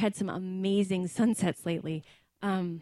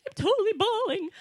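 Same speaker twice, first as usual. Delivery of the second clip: 1 imitating crying sister; 10 semitones higher, faster than usual. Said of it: "she's like".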